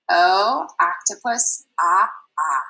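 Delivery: "ah, ah" as the short o in octopus; short o sound. Shown as a demonstration. Teacher drilling the short O sound. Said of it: "short sound for O is ah".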